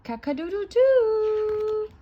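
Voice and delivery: long, high, held rooster crow; proud rooster crowing at full stretch. A narrator's reading. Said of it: "crowed proudly".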